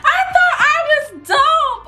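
sehr hoch und laut, lachend; laughing. Real, not acted. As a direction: vor Lachen quietschen. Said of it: "laugh cry".

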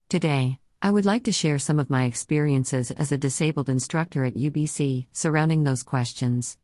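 synthesized text-to-speech voice; computer-generated voice. Real, not acted. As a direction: speak like a computer voice reading text. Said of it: "I use a computer-generated voice, as you can hear now".

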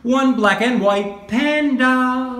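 slow, high, over-clear teacher voice; drawn out and bright for a child; slow clear modelling. Shown as a demonstration. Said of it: "teacher models phrase slowly".

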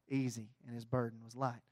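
normal talking voice

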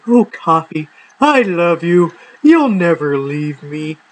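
mock-creepy lover's croon to the coffee tin. Nuzzling his coffee canister each morning, hammed-up creepy devotion. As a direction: coo at the canister like a creepy admirer, slowing at the end. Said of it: "other creepy things that people shouldn't talk about".

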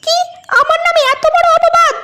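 high put-on wife's voice, scandalised; outraged wife. Wife quarrels with husband. (অপবাদে ক্ষুব্ধ বউয়ের তীক্ষ্ণ উঁচু গলা)

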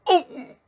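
loud high cry of pain; pained yelp. Acted. Ah covered in biting ants, yelping in pain.

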